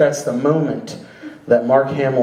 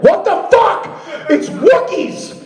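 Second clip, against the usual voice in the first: much higher, hammed-up yelling; mock exasperated shout; 12 semitones higher, slower than usual. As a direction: yell at the director in mock disbelief.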